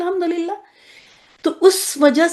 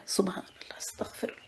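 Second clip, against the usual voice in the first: half-voiced murmur under her breath; whispered aside; 4 semitones lower, 20 dB quieter than usual. Real, not acted. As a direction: mutter it to yourself. (آہستہ سرگوشی)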